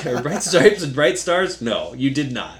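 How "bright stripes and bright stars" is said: high, loud, laughing through the words; laughing misquote. Retold or imitated. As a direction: giggle out the swapped lyric. Mocking her wrong anthem words.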